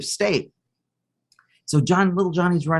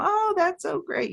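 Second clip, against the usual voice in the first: very high, sing-song mom praise; high-voiced, doting reply; encouraging mom; 3 dB quieter than usual. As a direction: imitate a mother cooing approval from the bench. Mom waves back at boy.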